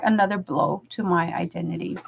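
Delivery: normal talking voice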